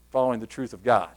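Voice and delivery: usual speaking voice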